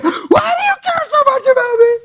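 piercingly high screamed imitation; imitated freak-out. Woman demands why pastor cares, imitated freak-out. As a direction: scream it wildly, as if hysterical. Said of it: "Freaking out, swinging at the air".

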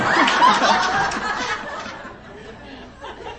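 very high breathy peal of laughter. Real, not acted. Laughing at her own nail-place joke, a high laugh.

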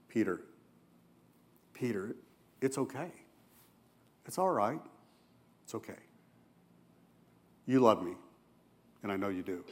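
soft, hushed reassuring voice; tender comfort. Retold or imitated. Jesus softly consoling a grieving Peter, hushed and tender. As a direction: gently soothe him, barely above a breath.

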